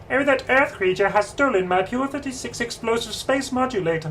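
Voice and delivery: high-pitched cartoon Martian voice; Marvin the Martian. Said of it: "Marvin the Martian, my brother used to do".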